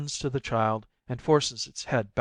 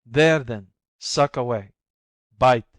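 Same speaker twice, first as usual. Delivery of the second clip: bitter; 8 dB louder than usual. Acted. Bitterly nursing the child.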